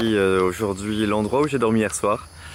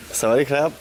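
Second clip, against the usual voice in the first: put-on higher playful Thai accent; joking Thai-accented greeting to the elephants; 5 semitones higher than usual. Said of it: "vous aurez entendu mon accent thaï".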